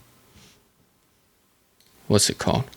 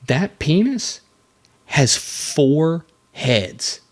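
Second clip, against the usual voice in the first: dramatic reveal; 4 semitones higher, much slower than usual. Acted. Shocked reveal of animal fact.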